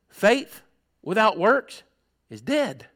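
each phrase set apart by pauses, dropping at the end; solemn pronouncement. Retold or imitated. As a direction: let Faith, without works, is dead land one by one. God's answer, weighty.

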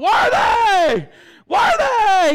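high, drawn-out mock holler; imitated excited shouting. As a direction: holler it like an overexcited new convert. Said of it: "he couldn't stop shouting".

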